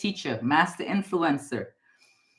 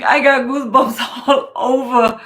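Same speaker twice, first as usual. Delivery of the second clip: higher, louder, laughing through the words; 6 semitones higher, 9 dB louder than usual. Real, not acted. Psychic tells viewer Phil she has goosebumps, laughing. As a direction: giggle with real goosebump excitement. Thrilled laugh mid reading.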